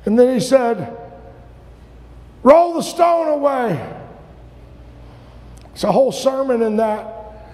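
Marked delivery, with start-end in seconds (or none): 2.4-3.8 high, ringing order; raised-pitch dramatic command; preached command. Acted. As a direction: call out the command from the pulpit. Acting Jesus' command at the tomb.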